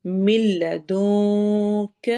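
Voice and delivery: ملدونک chanted very slowly, nun hidden and stretched; very slow recitation of ملدونک with hidden nun; hidden nun, lengthened. Shown as a demonstration. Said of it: "نون کو ہم نے چھپا کر لمبا کر کے پڑھنا ہے".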